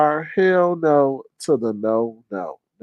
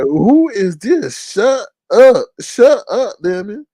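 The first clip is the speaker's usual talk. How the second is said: higher, louder voice of an annoyed dog; a lightly voiced fed-up dog; dog's grumbling; 7 semitones higher, 3 dB louder, slower than usual. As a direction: play the dog telling Lemon to shut up. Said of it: "that dog be over there".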